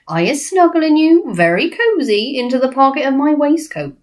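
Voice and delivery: lilting giant with relish; friendly giant, reassuring. Acted. BFG offering Sophie his pocket, friendly giant voice.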